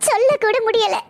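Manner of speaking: high, tearful, helpless lament; tearful despair. Acted. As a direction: break down while trying to explain. Distraught mother pleading with doctor. (அழுகையோடு தவிப்பு)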